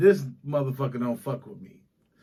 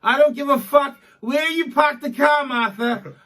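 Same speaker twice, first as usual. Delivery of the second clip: put-on loud, higher Boston accent yelling; 7 semitones higher, 6 dB louder than usual. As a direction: bark it like an old Boston guy. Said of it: "people in Boston will be like".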